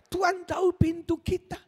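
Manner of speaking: high, loud, impassioned preacher voice; full-force preaching. Acted. Preacher to congregation, high and loud, impassioned. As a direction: drive the story home to the crowd, loud and high.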